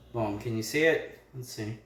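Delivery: usual speaking voice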